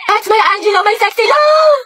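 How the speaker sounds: high, giddy fan squeal; swooning cheer. Acted. (kinikilig na tili)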